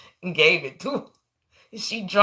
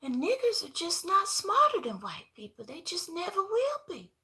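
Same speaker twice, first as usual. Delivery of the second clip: mock spoiled girl; 6 dB quieter than usual. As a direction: say it like a sweet little girl, high. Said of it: "She said".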